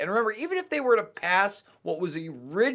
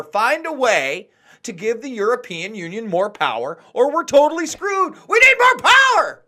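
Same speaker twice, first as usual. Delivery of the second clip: building from a mild mock voice to a high, loud shout; mock bureaucrat rant; 4 semitones higher, 6 dB louder than usual. Mocking an EU bureaucrat to his co-host, building to a shout. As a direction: parody a power-hungry bureaucrat, rising to a yell. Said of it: "He's basically saying".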